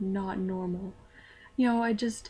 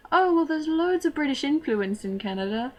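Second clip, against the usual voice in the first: higher, louder put-on British accent; 5 semitones higher, 4 dB louder than usual. Student explaining herself to classmates, in a fake British accent. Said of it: "I would have to talk to everyone that way".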